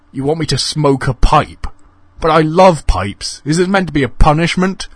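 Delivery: high, loud and disbelieving; incredulous. A narrator's reading. Donald baffled by pipe offer.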